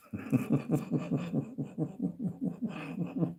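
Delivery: long high-pitched giggling fit; helpless high cackle; laughter. Real, not acted. Amused by chat's Aussie slang.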